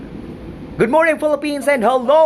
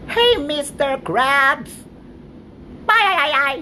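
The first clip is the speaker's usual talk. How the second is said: SpongeBob-style squeak; 3 semitones higher than usual. Pretend. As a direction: squeak it like an excited cartoon sponge. High cartoon voice greeting.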